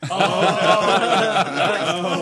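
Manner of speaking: laughing. Real, not acted. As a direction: laugh through the words as you tell it. Laughing while telling about friends.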